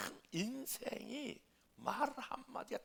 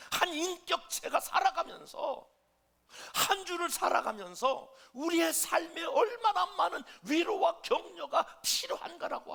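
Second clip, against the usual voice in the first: high, shouted sermon climax; impassioned preaching; 13 semitones higher, 11 dB louder than usual. Real, not acted. Pastor preaches on people needing comfort, impassioned shout. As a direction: drive the point home at full voice. Preaching the point at full force.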